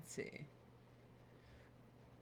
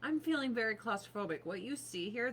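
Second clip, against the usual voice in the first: ordinary delivery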